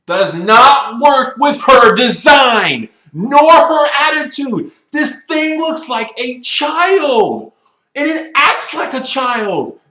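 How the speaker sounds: loud, high, drawn-out outburst of exasperation; fed-up rant at full volume; exasperated shouting. Real, not acted. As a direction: shout it slowly, hitting every word. Exasperated rant about mismatched character design.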